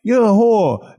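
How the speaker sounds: put-on Boston accent. Imitating movie family insulting mom, mock-angry Boston accent. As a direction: exaggerate the Boston accent, long drawn vowel. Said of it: "Now I'm doing a Boston accent".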